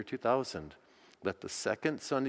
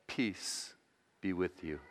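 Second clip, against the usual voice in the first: softer, tender blessing; gentle peace; 4 semitones lower, 6 dB quieter than usual. Pretend. Jesus greeting the frightened disciples, soft and gentle. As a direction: let it settle softly after the storm.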